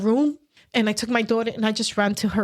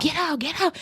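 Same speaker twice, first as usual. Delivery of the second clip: retold scream; 7 semitones higher than usual. Husband screaming at wife's mother to leave, retold scream. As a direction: imitate his screamed order, pitched up. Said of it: "he was just screaming at her".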